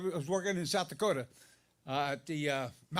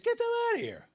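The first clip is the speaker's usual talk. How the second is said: surprised squeak; much faster than usual. Retold or imitated. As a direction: voice jumps way up in surprise. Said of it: "My voice goes up when I'm surprised".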